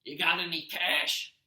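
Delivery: a lazy slob asking for money; gruff Gabe voice. Acted. Stepdad Gabe demands cash from Percy, gruff and slow. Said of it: "That was it".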